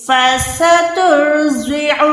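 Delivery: فَسَتُرْضِعُ with a thick ر and heavy ض, slow chant; slow chanted demo. Shown as a demonstration. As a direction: darken the ر in فَسَتُرْضِعُ. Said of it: "را کو موٹا رکھیں".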